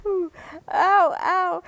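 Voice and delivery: high ouch of pain; mock pain. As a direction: yelp like a cramp hits. Reliving cramp pain.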